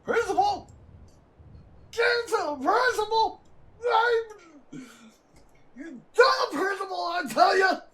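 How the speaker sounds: high, loud screechy mock yell; mock hysterical old man. Mimicking the teacher screaming for the principal, high mock yell. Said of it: "I can match your voice".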